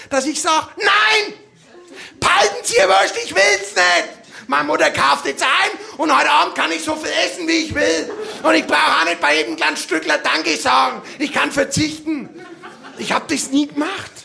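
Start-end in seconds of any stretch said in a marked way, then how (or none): 0.8-12.3 higher, louder outburst; defiant refusal. Pretend. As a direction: tell the butcher off. Imagined defiant refusal as child. (trotzig, laut und hoch)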